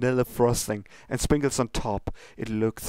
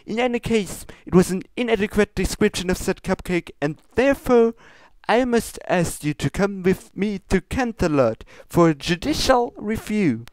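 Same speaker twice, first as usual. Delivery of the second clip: high, formal princess scolding; lofty royal reproach, pitched high; 10 semitones higher, 6 dB louder than usual. Acted. Luna scolds Pinkie about her ingredients, high and prim.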